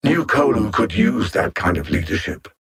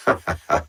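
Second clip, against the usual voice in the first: low villain chuckle; 9 semitones lower than usual. Acted. Villain laughs after gloating.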